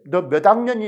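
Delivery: 학년이 ending short, Jeolla way; 학년이, Jeolla dialect question. Shown as a demonstration. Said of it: "이렇게 전라도 사람 물어보지".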